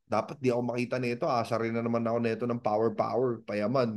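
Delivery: soft, fast, furtive; hushed hiding. Retold or imitated. Broke networker hiding from friends, hushed and furtive. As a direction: talk low so no one finds you.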